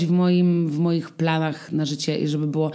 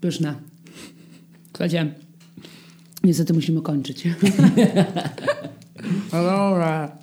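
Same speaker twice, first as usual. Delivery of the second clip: chewing between words, laughing; mouth full. Real, not acted. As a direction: talk through a bite of cake. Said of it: "z pełną buzią".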